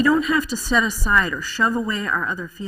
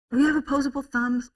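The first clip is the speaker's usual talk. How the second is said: normal talking voice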